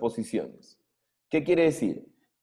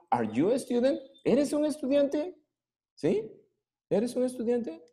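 much higher, rising question; 12 semitones higher, 3 dB quieter than usual. Shown as a demonstration. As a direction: pitch it up high as a question. (pregunta muy aguda que sube al final)

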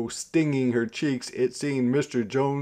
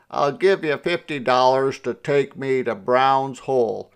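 loud, moderately raised call; shouting; 3 semitones higher, 7 dB louder than usual. A narrator's reading. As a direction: shout the offer over to the driver. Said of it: "Mister Jones shouted".

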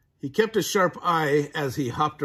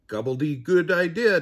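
higher, louder gobbling cheer; eager Turkey; 5 semitones higher, 6 dB louder than usual. A narrator's reading. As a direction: announce the plan with a burst of glee. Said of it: "Turkey said".

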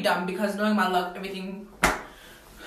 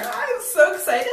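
ordinary talk, nothing marked

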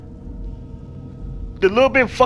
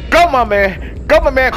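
ordinary talk, nothing marked

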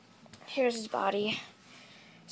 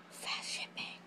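whisper; 16 dB quieter, slower than usual. Real, not acted. Whispered echo.

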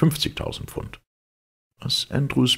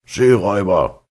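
loud, higher furious yell; furious shout; 6 semitones higher, 7 dB louder than usual. A narrator's reading. Captain screaming at Fogg for seizing his ship, furious. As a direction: hurl the insult at him.

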